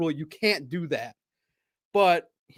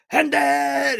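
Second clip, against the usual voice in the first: high, loud heartfelt cheer of a name; fan's heartfelt cheer; imitated cheer; 8 semitones higher, 4 dB louder than usual. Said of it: "he cheered from his heart".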